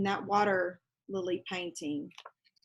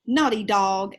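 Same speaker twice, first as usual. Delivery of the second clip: louder mock scolding; shouted; 8 dB louder than usual. Julie scolding her paint-covered dog, mock shout. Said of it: "shouted Julie".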